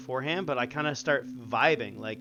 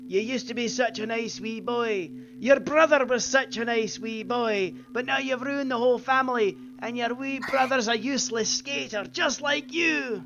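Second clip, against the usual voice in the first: much higher, scolding Scottish mum, far from his own voice; imitating mom; 10 semitones higher than usual. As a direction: nag like a disappointed Scottish mother. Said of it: "She would be like".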